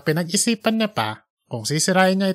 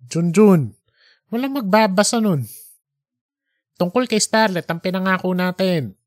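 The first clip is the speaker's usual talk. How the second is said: higher, quickening boss voice; pushy editor; 4 semitones higher than usual. Acted. Editor demanding the recording from Junjun, impatient. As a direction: rush him into handing it over.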